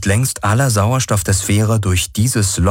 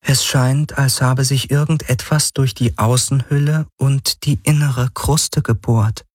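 higher, composed analytical voice; the Helix, an artificial mind; 7 semitones higher than usual. Acted. Helix explains the breach to Galen, higher and composed. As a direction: explain it coolly, from above.

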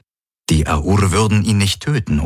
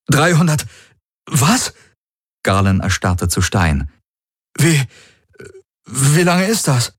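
shocked disbelief; 6 semitones higher than usual. Acted. Galen shocked.

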